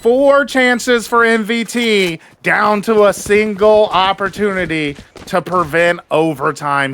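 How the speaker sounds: fast, high play-by-play call; esports caster. Real, not acted. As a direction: build hype through the clutch. Live play-by-play of a clutch.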